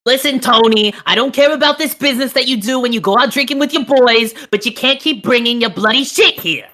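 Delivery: high, nagging mafia wife; hammed-up mob wife telling him off. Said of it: "I'll be the wizard's mafia wife then".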